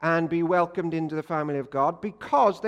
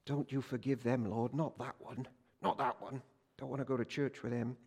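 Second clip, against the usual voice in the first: a much softer muttered prayer against neighbours; grudging prayer; 7 semitones lower, 12 dB quieter than usual. Pretend. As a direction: pray under your breath, begging God not to forgive them. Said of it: "bad people on your street".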